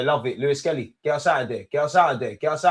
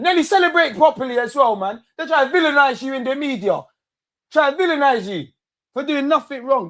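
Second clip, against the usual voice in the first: excited praise; 11 semitones higher, 4 dB louder than usual. Real, not acted. Hyping player excitedly.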